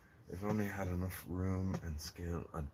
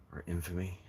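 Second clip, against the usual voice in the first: ordinary talk, nothing marked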